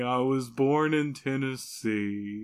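dejected drawl, drawn right out; dour, dragging Southern confession; sad, slow Southern accent. Acted. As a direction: confess glumly and slowly. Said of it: "why are you speaking slower".

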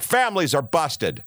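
normal talking voice

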